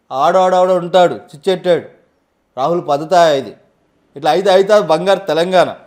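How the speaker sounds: loud, punchy political speech voice; politician impression. Shown as a demonstration. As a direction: boom it out like a fiery Telangana leader. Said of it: "ఇట్లా dialogues వరకు చెప్పగలుగుతాం".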